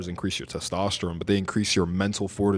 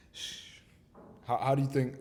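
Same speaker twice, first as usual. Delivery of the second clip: ordinary talk, nothing marked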